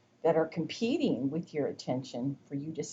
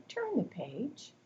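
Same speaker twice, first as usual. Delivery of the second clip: direct instruction; 7 semitones higher, 8 dB quieter, slower than usual. Shown as a demonstration. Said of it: "to give them a direct request".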